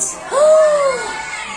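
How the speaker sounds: sharp high gasp of wonder; surprised gasp. Acted. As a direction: gasp in amazement.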